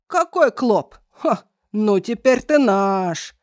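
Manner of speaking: loud, slow triumphant giant; gloating giant. Acted. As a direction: gloat over the captive. Giant gloats over captive.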